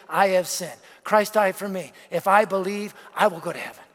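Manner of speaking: lightly voiced five-year-old reciting, kept low; child's simple recital. Son counting gospel points on his fingers, lightly voiced simple recital. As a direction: tick off each point like a child. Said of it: "in a five-year-old way".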